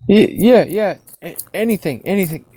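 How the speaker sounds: high, stammering, eager agreement; begging for mercy. Acted. Cowed ruffians agree to leave him alone, high stammered pleading. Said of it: "going to beg mercy at this point".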